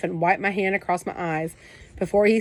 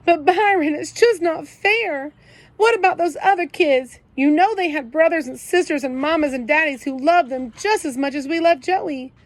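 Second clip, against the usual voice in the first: tearful plea; 9 semitones higher, 4 dB louder than usual. A narrator's reading. Kenny crying about the other kids.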